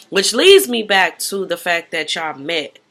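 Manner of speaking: ordinary delivery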